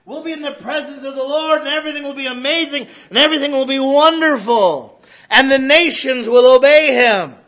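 loud, rising sermon cadence, high and ringing; full-force preaching. Acted. Pastor proclaiming heaven to the congregation, full-force preaching. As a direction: build it like a pulpit climax.

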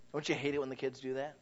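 ordinary delivery

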